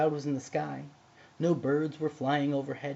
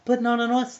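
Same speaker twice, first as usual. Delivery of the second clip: loud, much higher protest; alarmed cry; 9 semitones higher, 10 dB louder than usual. A narrator's reading. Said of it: "the oysters cried".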